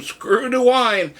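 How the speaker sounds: high, slowed mock call; lightly hollered, drawn-out chant; retold yell. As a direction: imitate people calling to the room. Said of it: "they yell to the room".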